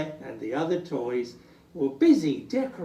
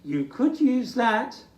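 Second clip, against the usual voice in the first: higher, louder eager dog; Ruff's voice; 9 semitones higher, 4 dB louder than usual. Acted. Ruff offering his bone, eager. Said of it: "said Ruff".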